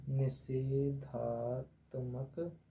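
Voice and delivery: निषेधात्मक very slowly, syllable by syllable; slow dictation. Shown as a demonstration. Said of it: "तीसरा है आपका निषेधात्मक निपात".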